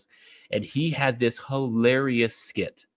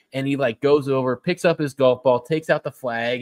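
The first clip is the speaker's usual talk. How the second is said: ordinary delivery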